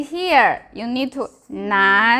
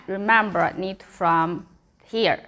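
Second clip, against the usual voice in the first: ordinary talk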